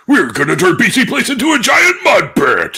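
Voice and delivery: slow, high, shouted arena announcer; monster truck voice. Pretend. Showing friends his voice, shouting as monster truck guy. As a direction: bellow it like a monster truck commercial. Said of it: "a monster truck guy voice that I usually do".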